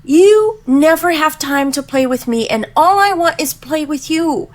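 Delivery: put-on high, pleading child voice through the whole complaint; imitating crying daughter. Mother voicing her daughter's tearful complaint in a high child voice. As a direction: whine it like an upset eight-year-old. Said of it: "And she's like".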